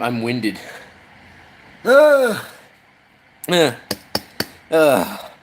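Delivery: ordinary talk, nothing marked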